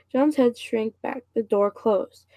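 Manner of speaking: ordinary delivery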